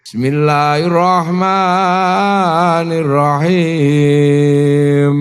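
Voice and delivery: slow, drawn-out gentle recitation. Shown as a demonstration. The gentle class reciting, slow drawn-out voice. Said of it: "Sayyidina Utsman itu lembut".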